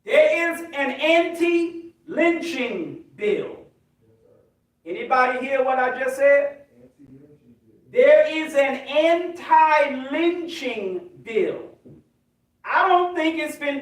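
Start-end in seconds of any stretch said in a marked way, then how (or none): 0.0-3.6 very slow, deliberate word by word; drawn-out, emphatic statement. Real, not acted. Spelling out his point for emphasis. 8.0-11.7 very slow, deliberate word by word; drawn-out, emphatic repetition. Real, not acted. Repeats the point for emphasis.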